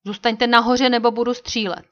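loud, forceful threat; warning shout. A narrator's reading. Old Shatterhand aiming at men climbing down, warning shout. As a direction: call up a sharp warning, loudly. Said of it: "výstražně zvolal".

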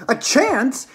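high, loud, incredulous yell. Acted. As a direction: yell it back in disbelief. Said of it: "yelled Missus Salt".

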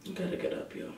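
very soft, drowsy mumble; falling asleep. Real, not acted. Sick vlogger urging herself up, drowsy and very quiet. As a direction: say it barely aloud, nearly asleep. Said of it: "I'm about to fall asleep".

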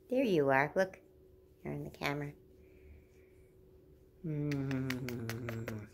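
normal talking voice

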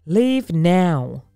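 loud, urgent royal command. Acted. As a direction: order them out in a panic. Frightened Pharaoh orders them out.

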